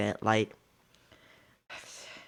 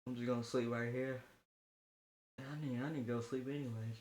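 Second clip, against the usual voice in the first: much softer, mumbled fast; dazed; 19 dB quieter, faster than usual. Retold or imitated. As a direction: drift off as if knocked out. Dazed after getting hit.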